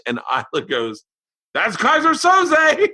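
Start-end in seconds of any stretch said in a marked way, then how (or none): 1.6-2.9 very high, drawn-out mock yell; shouted reveal. Imitating his daughter blurting out the film's twist, shouting excitedly.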